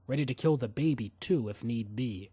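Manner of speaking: ordinary delivery, nothing marked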